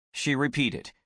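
ordinary delivery

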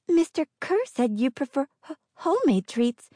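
high timid yes ma'am; meek and eager; timid girl voice. A narrator's reading. Inga speaks nervously.

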